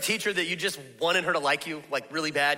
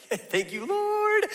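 relieved thank you; much slower than usual. Pretend. Parents relieved school starts.

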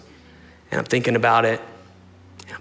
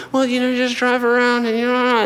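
high-pitched mock mother voice; mimicked rambling mom; 10 semitones higher than usual. Mom gives son vague directions, high mocking imitation.

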